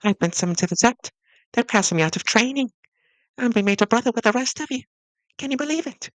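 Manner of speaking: loud, high and thrilled; excited. Acted. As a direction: burst out with the happy news. Young man shares joyful news.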